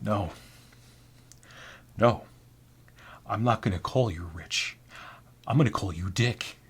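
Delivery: quiet, halting pronouncement; decide it softly, with long pauses; odd coworker's refusal. Acted. Weird coworker refusing the name.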